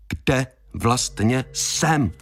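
"Kde vlastně jsem" in three separate, drawn-out pieces; very slow, word-by-word "Kde vlastně jsem"; slow, bewildered question. A narrator's reading. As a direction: pause after "Kde" and "vlastně", lift "jsem".

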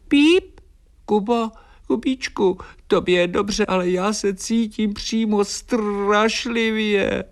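very high, pitiful bird squeak; unwell little swallow. A narrator's reading. Swallow calls down to Kuba that she feels dreadful, high and plaintive. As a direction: peep a complaint in a tiny, high voice. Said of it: "ozvalo se mi nad hlavou".

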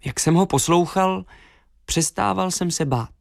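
plain reading voice

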